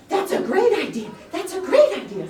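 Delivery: high, loud, delighted hare voice; a thrilled, bouncy rabbit; eager hare. Acted. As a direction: jump on the idea with glee.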